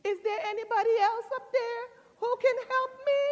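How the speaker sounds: high, loud, rushed mock cry; comic panicked call for help; man calling to heaven. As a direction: shout up quickly for someone else. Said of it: "looked up to heaven and he says".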